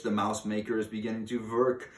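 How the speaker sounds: usual speaking voice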